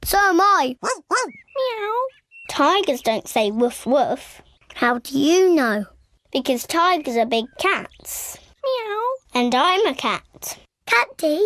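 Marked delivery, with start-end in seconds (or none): 0.8-1.9 soft mock kitten meow; playful meow. Child pretends to be a cat. 8.6-9.0 soft mock kitten meow; playful meow. Child pretends to be a cat.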